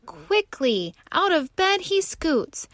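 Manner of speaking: usual speaking voice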